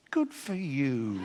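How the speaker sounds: slow, drawn-out condescending praise; patronizing. Retold or imitated. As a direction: humour them with a patronizing, stretched-out delivery. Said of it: "I'm a lot more patronizing".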